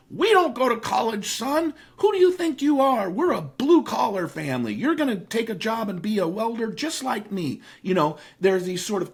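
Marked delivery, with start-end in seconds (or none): 0.1-7.6 hammed-up dad putting his son in his place; mock stern blue-collar dad. Blue-collar dad scolding son about college, mock stern.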